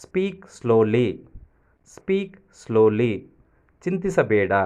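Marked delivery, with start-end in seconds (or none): 0.0-3.1 slow drill. Shown as a demonstration. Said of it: "ನಿಧಾನವಾಗಿ ಮಾತನಾಡು".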